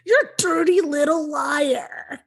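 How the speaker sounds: slow, high, mocking mean-girl jab; Janis Ian taunt. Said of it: "like Janice Ian?".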